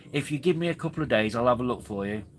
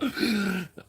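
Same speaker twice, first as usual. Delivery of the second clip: high mock gasp; imitated gasp; 10 semitones higher than usual. Retelling his mate's reaction to criticism, mock gasp. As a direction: gasp in pretend shock, as a retold reaction. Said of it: "And he was like".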